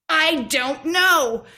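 put-on sulky denial like a child; bickering voices. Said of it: "had this argument with myself".

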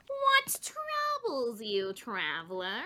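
fantasy innkeeper voice. Pretend. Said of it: "my brain is still logging out of Azeroth".

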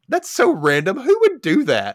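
high, loud mock incredulity; sarcastic disbelief. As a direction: rise in pitch with mocking disbelief. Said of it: "I'm like".

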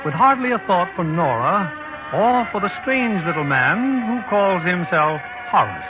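ordinary delivery

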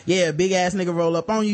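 ordinary talk, nothing marked

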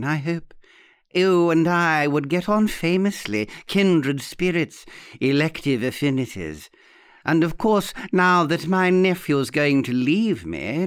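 theatrical old lady, mock-plaintive; gush, then play the lonely woman; arch old aunt. Aunt playing on nephew's coming departure, archly plaintive. Aunt's voice in the story.

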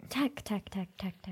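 hushed, unvoiced-breath whisper. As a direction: whisper the name over and over to rouse him. Softly rousing an unconscious friend.

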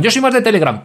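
a smug listener who prefers another app; mock listener. Mocking listener excuses.

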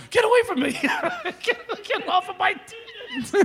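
high, squealing mock-child protest; hammed-up child voice, breaking into laughter; auditioning little kid. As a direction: squeal like a kid fending someone off. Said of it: "these little kids are auditioning going".

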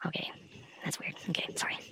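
spooked hushed whisper; hushed, creeped-out whisper; weirded out. Real, not acted. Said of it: "my spider senses are going crazy right now".

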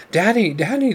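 high, happy cries of Daddy; joyful cry. A narrator's reading. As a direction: squeal with joy as he swings them. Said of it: "they cried".

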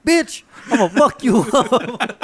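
high, loud mock outrage with a laugh; imitated startled threat. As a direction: play the scared dad snapping back. Retelling startled dad's threat.

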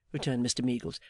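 ordinary delivery, nothing marked

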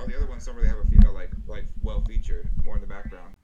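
loud, fast excited explaining. Real, not acted. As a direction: rush it out, loud and eager. Excitedly explaining poster guess.

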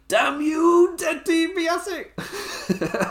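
very high drawn-out mock wail; hammed-up outraged cry. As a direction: curse your nemesis in a high, stretched cry. Acted cry of a cheated wrestler.

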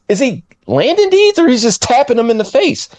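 high-pitched puzzled question; mock disbelief; incredulous. Acted baffled reaction to weak punches.

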